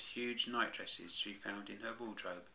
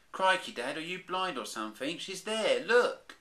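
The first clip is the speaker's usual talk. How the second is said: high, loud, building to a yell; a cub shouting and pointing; 10 semitones higher, 9 dB louder than usual. Acted. Baby Bear shouting to his father, building to a yell. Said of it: "he shouted".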